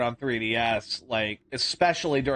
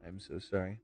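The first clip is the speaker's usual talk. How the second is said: stoned; 8 semitones lower, 13 dB quieter than usual. Real, not acted. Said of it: "I am really stoned right now".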